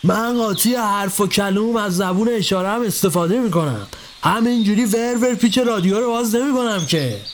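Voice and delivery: put-on old master brushing off his helper; Ebrahim's voice. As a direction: drag the words out like a scolding master. Ebrahim explains himself.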